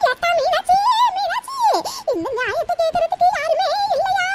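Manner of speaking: sobbing appeal for justice; hammed-up old woman's weeping; tearful wailing. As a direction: wail the name, then break into sobs. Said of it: "எதுக்கு அழுதுட்டு இருக்காங்க".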